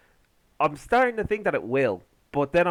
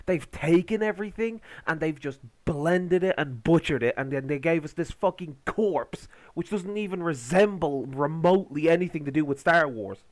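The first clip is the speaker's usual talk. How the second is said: usual speaking voice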